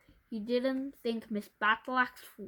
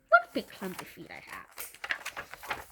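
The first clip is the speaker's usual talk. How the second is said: sly fake apology; 3 semitones lower, slower than usual.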